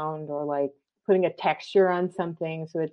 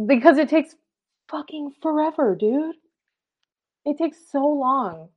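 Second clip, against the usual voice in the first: exasperated; 9 semitones higher, 3 dB louder than usual. Real, not acted. Exasperated rant, high and loud.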